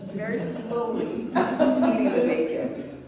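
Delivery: slow words broken by loud laughter; laughing. Real, not acted. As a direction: laugh through the telling. Laughing while telling bacon moment.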